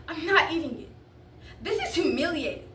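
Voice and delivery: humiliated, heated objection; indignant refusal. Acted. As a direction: dig in against your father. Teen refuses father's cat food breakfast.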